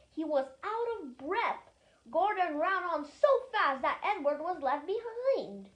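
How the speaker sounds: plain reading voice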